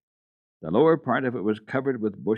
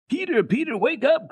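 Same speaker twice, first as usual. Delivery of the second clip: put-on very high, loud child's cry; girl shouting to a sleeping boy; 5 dB louder than usual. A narrator's reading. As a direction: wake him with a yell. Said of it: "cried Heidi at the top of her voice".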